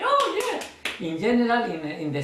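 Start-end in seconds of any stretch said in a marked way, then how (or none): 0.0-0.6 high happy exclamation; delighted. Shown as a demonstration. Magician to spectator, delighted exclamation. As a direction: burst out in delight. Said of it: "my attitude is say".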